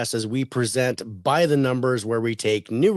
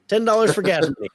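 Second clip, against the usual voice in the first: higher and louder, disbelieving; incredulous repeat; 8 semitones higher, 4 dB louder than usual. Real, not acted. Host repeats the dealer's gas money offer in disbelief. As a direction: hit it like you can't believe it. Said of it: "repeated as an incredulous punchline".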